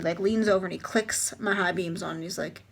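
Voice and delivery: ordinary talk, nothing marked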